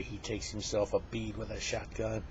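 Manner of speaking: normal talking voice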